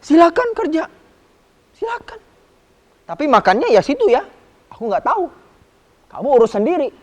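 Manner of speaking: high, loud careless boss voice; a high shrug-off from the pig farmer; pig farm owner. Acted. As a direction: play the indifferent owner brushing him off. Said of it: "Akhirnya si pemiliknya bilang".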